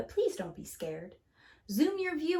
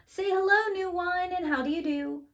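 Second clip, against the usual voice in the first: cheery greeting; 8 semitones higher than usual. Acted. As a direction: greet the new number with delight. Greeting a new number character.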